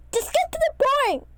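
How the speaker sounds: high, loud impatient shout; impatient yell. A narrator's reading. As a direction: snap it out loud. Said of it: "yelled Lucky".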